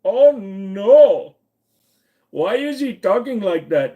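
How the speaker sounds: put-on loud, high Indian-accented dismay; mock-offended Indian accent. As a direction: wail like scandalised onlookers in a put-on Indian accent. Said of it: "I can see them all saying".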